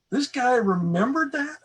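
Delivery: high, slow, astonished question; amazed disbelief. Retold or imitated. Employee blown away that boss remembered, amazed. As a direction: marvel at it, stretched out and high.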